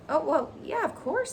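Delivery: high, squeaky hesitant agreement; flustered pig. Acted. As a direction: stammer it out uneasily. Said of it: "Wilbur caught off guard".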